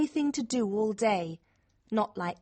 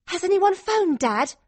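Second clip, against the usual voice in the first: high, louder urgent question; out of breath; 8 semitones higher, 6 dB louder than usual. A narrator's reading. Daughter asks Dad if anyone phoned, out of breath. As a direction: ask it urgently, a little breathless. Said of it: "I ask out of breath".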